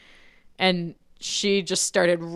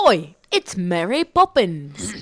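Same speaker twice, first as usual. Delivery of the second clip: put-on loud, slow, hammy Cockney accent; 6 dB louder than usual. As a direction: call out in bad stage Cockney, stretched out. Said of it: "What did he sound like?".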